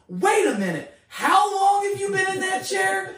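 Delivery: loud, high, shouted disbelief; freaking out. Retold or imitated. Prayer caller learns she was in a wheelchair, freaking out. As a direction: re-enact losing it on the phone. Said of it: "freaking out on the phone".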